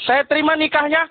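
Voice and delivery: stammering groom's vow ending in a shouted tunai; nervous groom botching the marriage vow; stammered wedding vow. Retold or imitated. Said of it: "kalau pilotnya hijab kabul".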